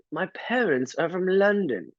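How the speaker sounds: put-on high, proper English-accented voice; prim British cashier. Mimicking the cashier's prim answer about London. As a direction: play her as stiffly proper. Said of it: "super proper".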